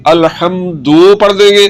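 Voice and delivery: अलहम्दु with the final u stretched long, slowly; drawn-out u. Shown as a demonstration. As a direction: stretch the last vowel of अलहम्दु into "duu". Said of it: "पेश को अगर लंबा करेंगे".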